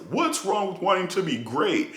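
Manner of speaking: high, sing-song mocking voice; mocking parroted take. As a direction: parrot the fans' tired line back at them. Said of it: "The most regurgitated take of this year".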